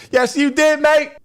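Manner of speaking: very high, very loud yell; mock shouted retort. He contradicts his friend, high mock yell. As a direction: shriek the comeback, hammed up.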